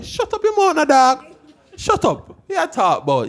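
hammed-up high "shut up your mouth"; mock protest. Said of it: "me there with my guts and say".

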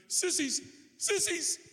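very high, mock-tearful child wail; hysterical little boy. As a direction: imitate a sobbing five-year-old tattling. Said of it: "he's hysterical".

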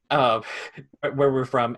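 ordinary delivery

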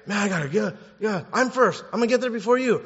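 high, excited scramble; eager racing boast. Acted. Lepers race to Jerusalem, high eager boasting. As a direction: blurt it like you're racing to be first.

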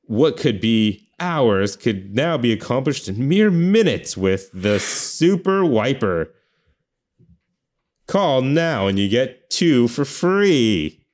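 slow, high pitchman pitch; infomercial. Pretend. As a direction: sell it like a late-night TV ad. Mock Super Wiper commercial.

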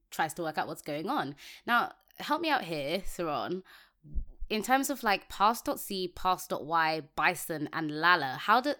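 usual speaking voice

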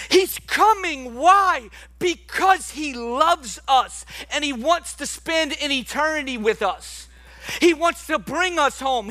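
rising sermon fervor; fervent preaching. Acted. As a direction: preach it out with passion. Preaching at full force.

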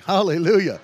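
loud ringing cry of praise; shouted hallelujah. Real, not acted. Preacher crying out praise at the miracle, loud. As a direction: let the Hallelujah burst out. Praise after wife's healing revealed.

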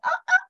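a hammed-up frightened stutter; scared stammer. Frozen with fear in trance.

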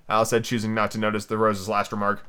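usual speaking voice

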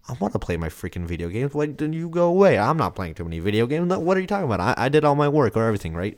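mock indignant teen comeback, pitch jumping up; defensive retort. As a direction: act out bristling at a parent's nagging. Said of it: "the immediate reaction is uncomfortable".

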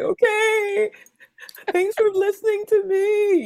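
very high weepy squeak; imitating a sobbing, comforted parent; mock tearful gratitude. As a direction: whimper thanks in a tiny, high voice. Retold tearful reply to calming child.

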